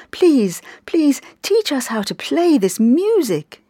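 pleading, pressing on teach; begging. A narrator's reading. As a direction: plead with the master to teach you. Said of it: "they begged to Avaya".

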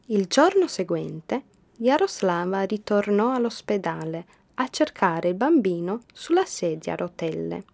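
usual speaking voice

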